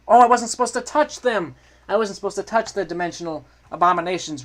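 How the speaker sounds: loud, high yelp of realisation; panicked exclamation. Pretend. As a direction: blurt it out loudly in alarm. Panicked reaction to touching monsters.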